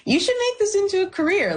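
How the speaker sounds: high-pitched imitation of a dad urging; advising dad. Dad advising a broke new graduate, high imitated urging. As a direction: voice a dad's keen suggestion, pitched high. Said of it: "my dad was like".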